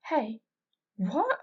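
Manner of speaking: jolted awake by an intruder; startled scream. A narrator's reading. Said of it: "I screamed".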